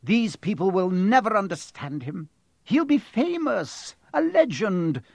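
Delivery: high, emphatic objection; incredulous protest. A narrator's reading. McGonagall objecting to explaining it in a letter, incredulous. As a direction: argue with rising agitation.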